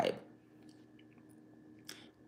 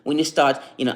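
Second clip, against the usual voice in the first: ordinary delivery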